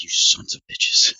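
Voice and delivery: seething whispered curse, slow; furious whisper. Retold or imitated. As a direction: hiss it under your breath in disbelief. Retold fury at phone store.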